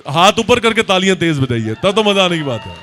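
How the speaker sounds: usual speaking voice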